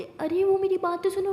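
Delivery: high, loud plea; गुहार लगाना. A narrator's reading. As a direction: plead to be heard over the scolding. Pleading during acted quarrel. (ऊँची आवाज़ में मिन्नत)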